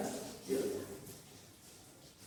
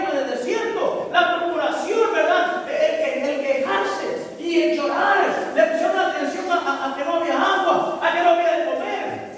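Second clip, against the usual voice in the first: raised, insistent preacher's voice; impassioned preaching; 6 semitones higher, 7 dB louder than usual. Real, not acted. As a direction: drive each point home to the congregation. Preaches at full force. (predicación insistente con voz alzada)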